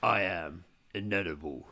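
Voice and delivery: low solemn villain line, mildly done; Thanos impression. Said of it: "Thanos raises the gauntlet".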